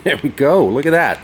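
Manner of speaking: high, loud cheer of relief; triumphant excitement. Real, not acted. Man seeing the pin go in at last, loudly triumphant. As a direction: exclaim it, thrilled it finally worked. Celebrates pin finally going in.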